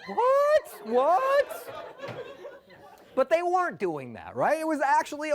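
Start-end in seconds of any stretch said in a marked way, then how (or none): 0.1-1.7 much higher, louder startled yelp; startled what. Acted. [name] hearing a ghost, startled high yelp. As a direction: jump at a voice out of nowhere.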